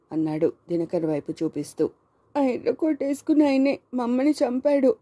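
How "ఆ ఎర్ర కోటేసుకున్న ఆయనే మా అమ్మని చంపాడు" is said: put-on high-pitched, tearful little boy; identify the killer through tears; tearful child. A narrator's reading. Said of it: "ధారాపాతంగా కారిపోతున్న కన్నీళ్ళని".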